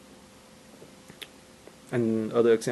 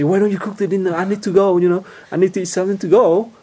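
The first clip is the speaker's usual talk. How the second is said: loud, higher demanding nag; mock yell; 7 semitones higher, 8 dB louder than usual. Husband yelling at his wife to cook dinner, loud mock yell. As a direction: bark the order at the wife, loud and high. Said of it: "you just yell".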